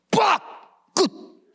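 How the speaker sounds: punchy hit sound, then done; mock smack. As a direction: snap it out like a slap. Imitating Busan dad's hit.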